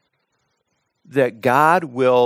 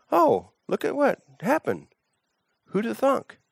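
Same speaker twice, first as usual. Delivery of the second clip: high, playful mock amazement, softer; mock surprise; 9 semitones higher, 4 dB quieter than usual. Preacher teases the congregation with mock amazement. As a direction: feign wide-eyed astonishment.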